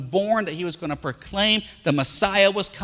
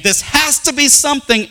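high, louder insistent quote; mock-insistent "This has to be something," said high and louder; insistent group; 10 semitones higher, 5 dB louder than usual. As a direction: mimic their certainty.

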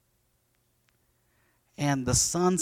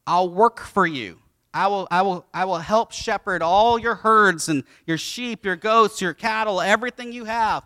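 louder, higher pitch of an offer; mildly hammed-up eager promise; eager bargaining; 5 semitones higher, 5 dB louder than usual. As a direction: act out an earnest sales pitch, piling up the list. Jacob's eager bargain acted out.

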